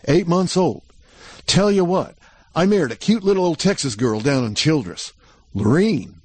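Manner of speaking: put-on a shaky, excited young cowboy; nervous cowboy showing off his news; eager cowboy Jack. Jack voiced higher.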